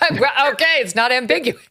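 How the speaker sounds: high-pitched, incredulous exclamation just after laughing; amused disbelief. Real, not acted. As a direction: pitch shoots up, half laughing. Reacting, laughing, to crack confession.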